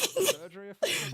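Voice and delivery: high, loud, laughing through the words; giggling punchline. Real, not acted. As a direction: blurt it out while cracking up. Quipping amid the ball bag joke.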